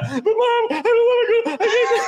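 very high, wailing mock-crying kid; imitated uncontrollable crying. Rider retelling a boy begging his mom, imitated wailing. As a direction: blubber it like a panicked boy. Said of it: "he's crying uncontrollably".

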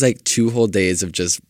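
ordinary talk

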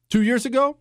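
ordinary talk, nothing marked

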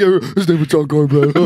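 lightly giddy retelling, about to burst out laughing; tickled dad. Retold or imitated. Dad repeating the funny name to mom, tickled. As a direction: imitate a dad cracking himself up. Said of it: "just tickled by it".

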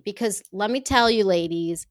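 ordinary talk

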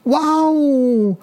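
put-on high startled cry of a falling child; startled yelp. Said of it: "被吓一跳的小鹿突然大叫". (受惊的尖叫，声调很高)

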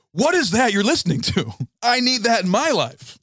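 high-pitched, urgent enthusiasm; excited demand. Acted. Sibling hearing The Prodigy for the first time, excited. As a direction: burst out as if instantly hooked. Acts out an impressed older sibling.

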